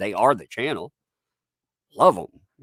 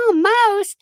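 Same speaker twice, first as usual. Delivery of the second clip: very high mock-outraged squeal; hammed-up high-pitched outrage; mocking detractors; 6 dB louder, much slower than usual. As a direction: imitate an indignant critic, loud and high. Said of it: "They're like".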